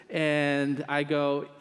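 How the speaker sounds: normal talking voice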